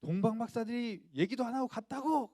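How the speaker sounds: high, loud outburst of royal fury; enraged King Herod. Acted. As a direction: bellow it like an outraged king. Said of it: "화를 막 버럭 내는 거였어요".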